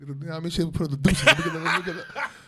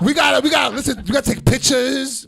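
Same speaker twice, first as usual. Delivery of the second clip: loud, high-pitched insistence; pushy, excited retold plea; imitating eager mom; 8 semitones higher, 8 dB louder than usual. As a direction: shout it like a mom keen for photos. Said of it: "My mom was all about that shit".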